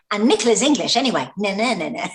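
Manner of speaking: quick, high, loud teasing jibe; mock taunt. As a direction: rattle it off like a playground tease. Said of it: "it was kind of a neh".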